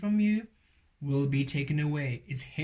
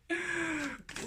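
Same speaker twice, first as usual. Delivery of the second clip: delighted laugh; 7 dB quieter than usual. Real, not acted. Delighted by the reading.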